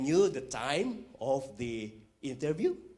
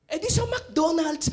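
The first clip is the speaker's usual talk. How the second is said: much higher, louder naive answer; eager Filipino answer; 13 semitones higher, 6 dB louder than usual. Retold or imitated. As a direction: blurt the obvious answer proudly. Filipino answers the translated question.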